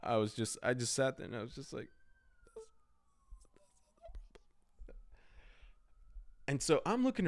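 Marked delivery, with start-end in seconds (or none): 2.4-5.0 barely voiced, halting denial; faint, broken-up self-reassurance; stunned whisper. Retold shock, car won't start.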